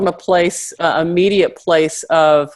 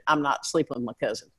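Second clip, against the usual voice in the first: deadpan Southern; 4 semitones lower, 9 dB quieter, faster than usual. Retold or imitated. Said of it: "Can you be more Southern?".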